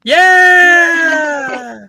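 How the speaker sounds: high, loud cheer; excited shout. Real, not acted. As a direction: whoop with relief. Cheering when audio gets fixed.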